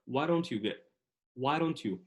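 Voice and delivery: American linked why don't you, D softened to a tap; pure American accent. Shown as a demonstration. Teacher demonstrating American speech, why don't you run together. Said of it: "بس باللهجة الأمريكية الخالصة".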